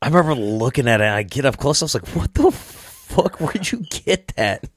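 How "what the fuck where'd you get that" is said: high, slow, drawn-out what the fuck; mock horror at the shirt; a stretched, high-pitched double take; shocked.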